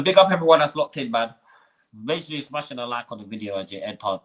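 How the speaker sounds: normal talking voice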